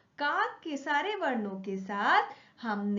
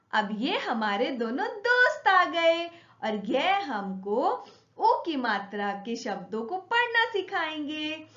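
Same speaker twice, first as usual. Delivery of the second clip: ordinary delivery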